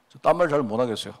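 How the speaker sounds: ordinary delivery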